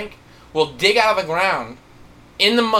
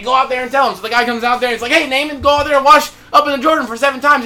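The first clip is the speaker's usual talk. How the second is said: loud, high voice relaying an order; retold orders; 6 semitones higher, 7 dB louder, faster than usual. Preacher acts out the servant telling Naaman to wash, loud retold order. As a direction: call it out raised, as in a lively retelling.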